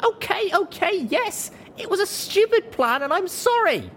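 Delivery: very high, pleading outburst; desperate. A narrator's reading. Narrator apologises to friends for stupid plan, desperate. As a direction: confess and plead at the top of your range. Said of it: "I looked to my friends desperately".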